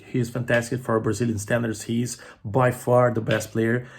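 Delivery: ordinary talk, nothing marked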